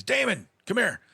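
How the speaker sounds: high-pitched call to come over. Retold or imitated. Pit guy hailing Damon outside the barn, calling out. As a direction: summon someone from across the yard.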